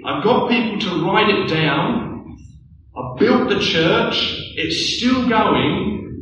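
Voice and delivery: earnest proclamation as Jesus, slowing into a gentler close; voicing Jesus. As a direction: plead high and insistent, drawing out the key phrases, then ease down. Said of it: "He's saying".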